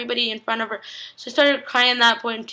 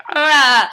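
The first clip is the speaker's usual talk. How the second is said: loud, higher-pitched "ah" outburst; mock crying wail; 4 semitones higher, 8 dB louder than usual. Real, not acted. Fan overwhelmed by the episode, loud wail. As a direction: cry out to fend off tears. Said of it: "I'm gonna start crying".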